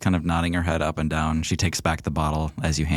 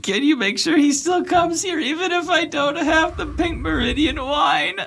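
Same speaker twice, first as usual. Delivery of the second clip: hammed-up bawling, very high and loud; tearful wail; 4 dB louder than usual. Weeping Clara begs for a visit, high bawling plea. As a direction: plead at full pitch through mock tears. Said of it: "a really hideous crying face".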